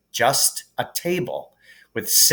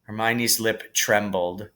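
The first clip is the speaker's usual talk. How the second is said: ordinary delivery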